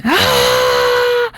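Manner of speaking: very high, held scream, kept contained; high mock scream; reenacted scream. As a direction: imitate a long scream without full volume. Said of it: "I start screaming".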